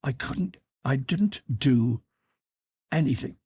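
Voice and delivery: understated nervous stammer. A narrator's reading. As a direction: falter between the words, slightly pleading. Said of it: "He began to stammer".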